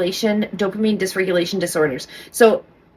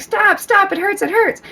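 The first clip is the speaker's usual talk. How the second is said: put-on high whiny child plea, much higher; imitating whiny kid; 11 semitones higher than usual. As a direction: squeak it out like a kid whose hair is pulled. Typical kid complaining about hairbrushing.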